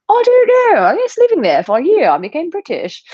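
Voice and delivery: high, loud hammed-up British accent; exaggerated British accent. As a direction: swoop up into a mock British voice. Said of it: "with a British accent".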